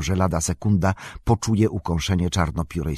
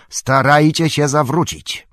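raised, higher urgent order; Aragorn; 8 semitones higher, 6 dB louder than usual. A narrator's reading. Aragorn urging the boats to turn, shouting. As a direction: call it loudly to the other boats. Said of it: "krzyknął Aragorn".

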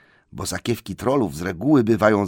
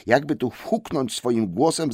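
usual speaking voice

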